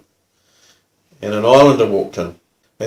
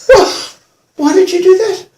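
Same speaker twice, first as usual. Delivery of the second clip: high, loud disbelieving question; acted bewildered outburst; incredulous; 6 dB louder than usual. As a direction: demand an explanation, astonished. Incredulously confronting his colleague.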